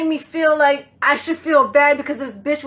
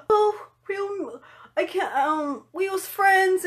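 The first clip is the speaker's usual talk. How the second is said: hammed-up sorrowful friend voice, higher and halting; mock heartfelt; 6 semitones higher, slower than usual. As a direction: fake the tearful speech of a grieving friend. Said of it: "like, a heartfelt".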